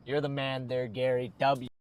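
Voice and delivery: normal talking voice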